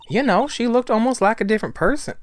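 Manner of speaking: high-pitched animated witness voice; lively witness. Acted. Acts the witness answering.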